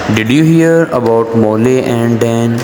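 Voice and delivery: slow, measured dialogue reading; rhythmic reading. Shown as a demonstration. Teacher reads dialogue to students with rhythm. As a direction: read it with a clear rhythm. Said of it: "मैं इसको rhythm के साथ पढ़ता हूँ".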